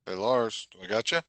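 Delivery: breaking up over a bad line; uneven volume. Real, not acted. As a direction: audio cutting in and out. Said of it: "your audio's breaking up".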